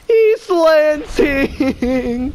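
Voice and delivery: put-on drunk lady. Drunk lady voiced in story.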